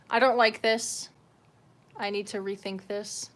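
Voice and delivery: ordinary delivery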